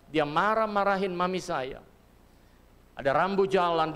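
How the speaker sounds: usual speaking voice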